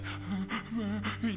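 child's gasping sob, lightly imitated.

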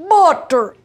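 Butter! called out high and loud; loud exclamation. Real, not acted. Cook announcing the butter to viewers, loud exclamation. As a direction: belt out the word like a cheer.

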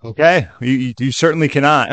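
usual speaking voice

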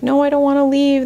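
a hint of a child's protest; imitated child. Mother quoting kids at grandparents' house, lightly imitated child.

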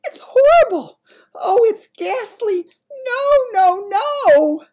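high, loud terrified sleep-talk cries; crying out in a nightmare. A narrator's reading. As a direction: scream out of a bad dream. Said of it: "it cried out".

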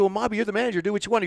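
high, lifted retold reply; animated retold answer; mock deferential. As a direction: toss the decision back to him. Leo answers, raised.

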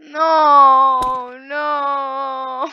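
long wailed no; anguished gamer wail, fading slightly; crying in despair. Real, not acted. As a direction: wail it out, drawn and loud. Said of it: "I'm actually crying right now".

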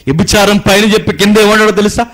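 loud, high, urgent sermon warning; fiery preaching. Acted. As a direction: thunder the warning at the congregation. Preaching at full force. (గట్టిగా హెచ్చరించే ప్రసంగం)

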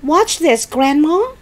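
put-on high-pitched boyish call; shouting. George calls Grandma to watch his trick, shouting. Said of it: "he shouted".

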